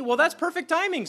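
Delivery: spring up with delight; Jesus, delighted. Acted. Jesus welcoming the Gentiles, bright and delighted. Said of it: "says Jesus".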